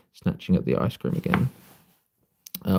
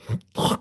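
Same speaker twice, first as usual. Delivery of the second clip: real throaty cough; phlegmy cough. Real, not acted. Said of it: "Sorry, phlegm".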